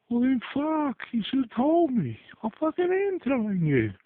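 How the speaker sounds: high, loud, angry back-and-forth; two people yelling at each other in a crisis; panicked argument. Retold or imitated. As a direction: snap back in a frantic quarrel. Uncle and boy arguing in panic.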